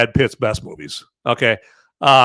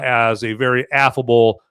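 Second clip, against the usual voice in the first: ordinary talk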